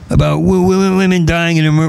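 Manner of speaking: ordinary delivery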